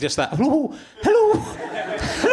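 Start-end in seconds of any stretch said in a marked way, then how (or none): 0.3-1.3 high, laughing comedy Scottish hello; comedy Scottish accent. Retold or imitated. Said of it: "I can't get enough of a comedy Scottish accent". 1.3-2.3 put-on whispered, laughing hello in a comedy Scottish accent; comedy Scottish accent, fading. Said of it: "I can't get enough of a comedy Scottish accent".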